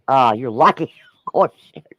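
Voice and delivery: ordinary talk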